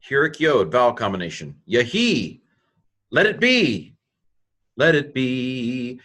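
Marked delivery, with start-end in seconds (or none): 4.8-6.0 slow, deliberate. Shown as a demonstration. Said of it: "teacher repeats translation".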